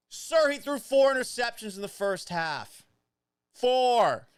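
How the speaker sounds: loud, high disbelief; Four! hit hard and loud; exasperated outburst. Real, not acted. Exasperated at critic's tweet.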